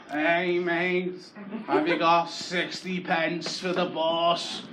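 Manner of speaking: drawn-out plea for bus fare; begging voice. Retold or imitated. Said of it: "There was a beggar going up and down".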